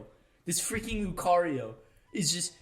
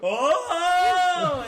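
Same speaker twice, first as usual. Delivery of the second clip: loud, high sustained whoa; held musical whoa; 13 semitones higher, 10 dB louder than usual. Retold or imitated. Gamer demonstrating his friend's held-note whoa. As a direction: belt one long note of amazement. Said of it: "held out a musical note".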